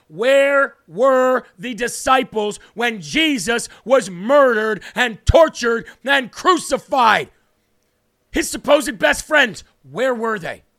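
loud, hammering sermon delivery; impassioned preaching. Real, not acted. Preacher demanding where the disciples were, impassioned and loud. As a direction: preach it forcefully, driving each stressed word home.

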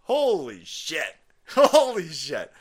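slow, drawn-out holy shit with a laugh; stunned disbelief. Real, not acted. Reacting to the senator's answer, slow stunned disbelief. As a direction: let it sink in, slowly.

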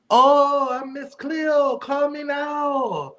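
put-on high, drawn-out Jamaican-psychic accent; Miss Cleo. Co-host demonstrates who the TV psychic was, hammy psychic accent. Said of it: "That was an awful accent".

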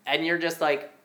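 normal talking voice

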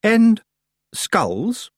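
loud high "and", then a pause; ominous pause before the last word; grave. A narrator's reading. Missus Earwig adds to her accusations, grave. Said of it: "gravely ignoring this".

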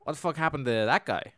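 acted-out alarmed demand; startled saint. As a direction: react to a body, hammed up a little. Voicing saint meeting the men.

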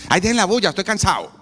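mock tired father snapping at home; grumpy tired parent. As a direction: snap at the noisy kids.

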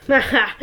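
put-on a sharp, high old woman's cackle; gleeful laugh of a thieving old woman; cackling laugh. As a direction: cackle over the spoil. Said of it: "replied the same woman".

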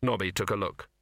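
ordinary delivery

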